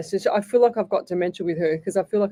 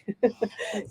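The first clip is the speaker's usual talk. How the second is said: soft laugh; 2 semitones higher, 7 dB quieter than usual. Real, not acted.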